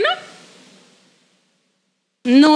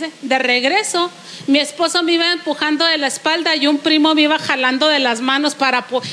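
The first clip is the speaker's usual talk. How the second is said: usual speaking voice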